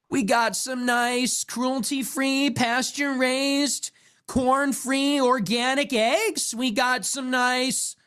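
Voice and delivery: high, slow uptalking influencer; mocking uptalk. Comic imitating a grocery influencer's uptalk. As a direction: lift every line up like a question. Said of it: "always the up talking".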